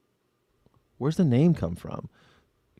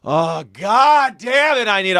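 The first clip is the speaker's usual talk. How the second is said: mock despair; 9 semitones higher, 5 dB louder, slower than usual. Despairing groan.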